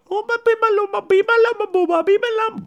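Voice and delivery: very high, eerie keening; mock cursing wail. Dying mother curses the nurse in a high eerie wail. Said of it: "she starts going".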